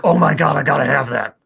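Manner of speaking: loud, eager retold outburst; excited. Friend seeing a picture of a bear-head cane, excited. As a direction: imitate a friend who badly wants it, punched out loud.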